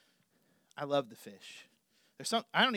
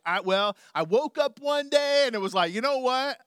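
mock confession; 7 semitones higher, 6 dB louder than usual. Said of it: "They didn't ever say".

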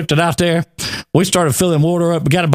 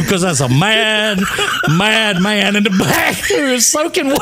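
higher, words giving way to laughter; cracking up; 6 semitones higher than usual. Real, not acted. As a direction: struggle to finish as real laughter takes over. Retelling while cracking up.

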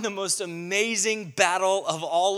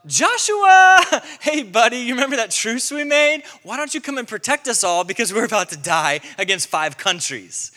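high, loud sheepish plea; a hammed-up desperate call for help; pleading Gibeonites; 4 semitones higher, 6 dB louder than usual. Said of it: "the Gibeonites are like".

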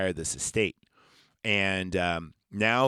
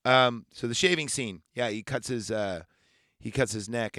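normal talking voice

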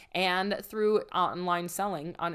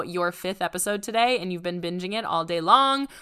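normal talking voice

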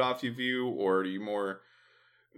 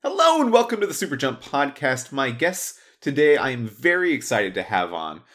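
bright, louder podcast welcome; podcast host intro; 3 semitones higher, 6 dB louder than usual. Acted. Host welcoming listeners to the podcast, upbeat announcer read. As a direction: open the show like a radio host.

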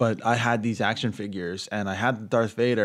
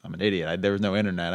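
ordinary talk, nothing marked

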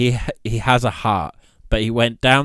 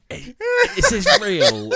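incredulous squeal; much slower than usual. Pretend. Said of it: "be like".

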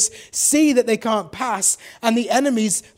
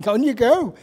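mock dismissive; 3 semitones higher than usual. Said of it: "I'd be like".

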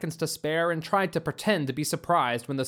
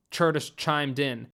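plain reading voice

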